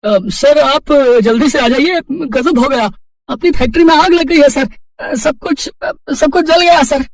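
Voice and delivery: high, loud, urgent alarm; frantic employee raising the alarm on the phone; panicked. Acted. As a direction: blurt out terrible news in a panic. Manager phones about factory fire.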